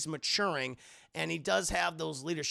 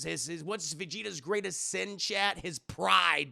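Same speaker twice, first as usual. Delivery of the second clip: usual speaking voice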